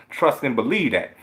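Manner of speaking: ordinary delivery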